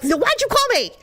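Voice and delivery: high, exasperated mock yell; retold angry comeback. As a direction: throw the question back, pitch shooting up. Re-enacting fight with mother.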